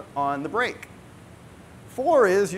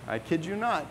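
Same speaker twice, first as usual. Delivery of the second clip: normal talking voice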